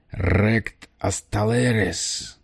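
slow, croaking guttural alien; gurgling alien voice. Acted. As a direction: croak it out slowly like an alien warrior. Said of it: "прокаркал он гортанным клокочущим голосом". (гортанный клокочущий голос пришельца)

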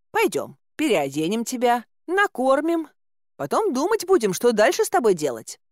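high, homely bustling voice; take-charge caretaker woman. A narrator's reading. Caretaker offering clothes and food, high bustling voice.

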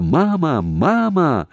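high, loud call for mama; crying out. A narrator's reading. Tanya grabs the receiver to reach mother, crying out. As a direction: cry out urgently into the phone. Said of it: "закричала".

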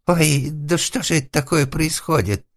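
high, loud, whining lament; wailing lament. A narrator's reading. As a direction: wail in helpless alarm. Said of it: "запричитал хозяин жилища". (высокое испуганное причитание)

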